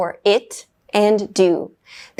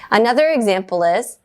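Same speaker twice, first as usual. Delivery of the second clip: normal talking voice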